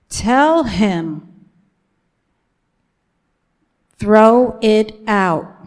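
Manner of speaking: loud, slow commanding voice; voice of God. Retold or imitated. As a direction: proclaim it as a divine order, each word weighty. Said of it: "it sounds like this".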